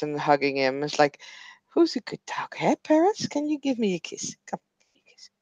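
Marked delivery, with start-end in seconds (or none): 1.7-4.4 high soft cooing voice; baby talk to a dog. Acted. 4.5-5.3 soft unvoiced whisper to a pet. Whispering to the dog.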